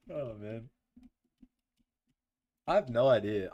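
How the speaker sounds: ordinary delivery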